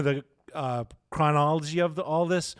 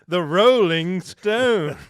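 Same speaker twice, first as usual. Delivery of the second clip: loud, slow, drawn-out Liverpool announcer; 5 semitones higher, 8 dB louder, much slower than usual. Retold or imitated. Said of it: "my [name] accent is way off tonight".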